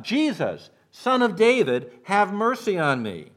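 high, loud cry for mercy; a blind beggar shouting to be heard; desperate shout. Acted. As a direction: call out over a crowd, begging. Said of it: "shouts out".

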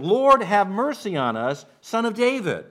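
high, loud cry for mercy; a blind beggar shouting to be heard; desperate shout. Acted. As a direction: call out over a crowd, begging. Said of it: "he is shouting".

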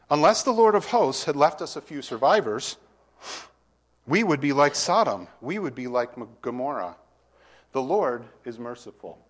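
solemn Bible reading from the pulpit; scripture reading. Acted. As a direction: read the verse out to the congregation with weight. Reads scripture aloud.

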